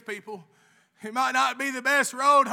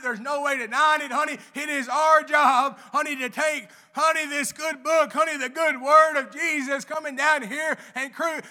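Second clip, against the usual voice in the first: rising, louder gospel exhortation, honey after every phrase; preaching at full force; 3 semitones higher, 6 dB louder than usual. Acted. Lay speaker exhorting the church to spread the word, full-force preaching. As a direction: drive it home like a revival preacher.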